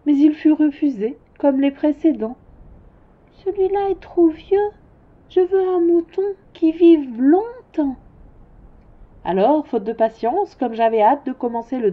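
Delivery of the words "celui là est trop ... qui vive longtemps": put-on higher child's voice, patiently correcting; higher child voice pointing out the horns; little prince.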